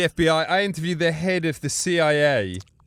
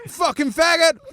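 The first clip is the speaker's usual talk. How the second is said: imitated jeer; 13 semitones higher, 4 dB louder than usual. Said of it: "people coming up going".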